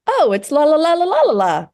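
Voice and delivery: high, bright sing-song assistant; chirpy AI voice. Retold or imitated. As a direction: chirp it like an eager helper bot. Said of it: "And it's like".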